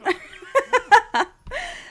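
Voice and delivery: high rising burst of laughter; real giggle. Real, not acted. Laughing at the Baron's makeover, a high giggle. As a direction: giggle helplessly at his look.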